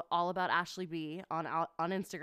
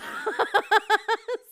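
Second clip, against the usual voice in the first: loud high laugh; 11 dB louder than usual. Real, not acted. Laughing at her octopus mix-up.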